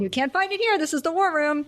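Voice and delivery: high-pitched quoted outrage; hammed-up admonishment; mock indignant scolding. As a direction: mimic a scandalized man in charge. Repeating the famous scolding line.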